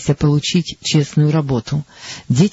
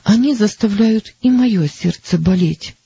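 quiet grief; 6 semitones higher than usual. A narrator's reading. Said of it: "надломленным голосом".

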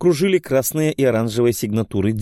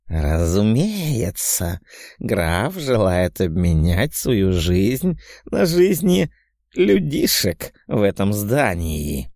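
slow, syrupy demon hiss; sugary hiss; 2 semitones lower, much slower than usual. Acted. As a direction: drawl it out like a gloating demon. Demon clown answers the count.

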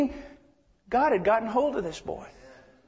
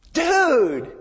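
higher, drawn-out "Dude!" like a bleached-blonde waiter; surfer dude kid; 6 semitones higher, 4 dB louder than usual. Retold or imitated. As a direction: imitate the young waiter's stoked "Dude!". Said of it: "I said that pretty good, didn't I?".

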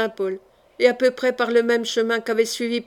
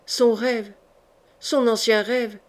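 ordinary delivery

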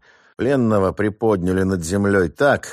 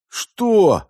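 shrill enraged "what", pitched far higher; flaring up; 13 semitones higher, 3 dB louder than usual. Acted. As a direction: explode with outrage. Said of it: "взвился главарь".

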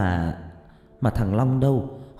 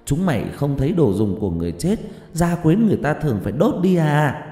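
loud, higher furious scolding; angry scolding; 6 semitones higher, 3 dB louder than usual. A narrator's reading. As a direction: berate them like a frightened, angry mother. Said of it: "hét ầm lên".

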